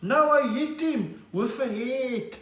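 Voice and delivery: high, very slow boxer voice; impression of Gerry Coetzee. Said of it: "They said".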